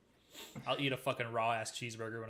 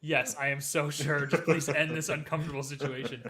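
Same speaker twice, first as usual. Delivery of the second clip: slow, louder, pleading to be done; exasperated insistence; 3 semitones higher, 8 dB louder than usual. Retold or imitated. Assuring the manager he is fine, mock exasperated. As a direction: drag out the yes, begging them to stop. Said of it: "And I was like".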